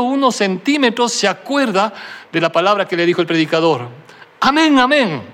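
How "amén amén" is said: urgent cry. A narrator's reading. Remembers word at cliff edge.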